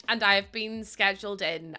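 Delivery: normal talking voice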